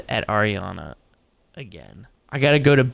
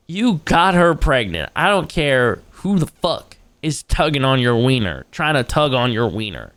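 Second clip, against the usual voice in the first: ordinary talk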